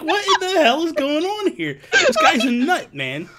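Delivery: loud, high mock outrage. Retelling his reaction to Joseph's skit, hammed-up outrage. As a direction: blurt it out, scandalised. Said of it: "I'm like".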